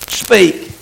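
normal talking voice

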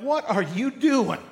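slow, high-pitched acted outrage; indignant demand. Coffee owner challenges the person who spilled it, indignant. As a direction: demand an answer, stretching each word. Contrasted with an easygoing "no worries.

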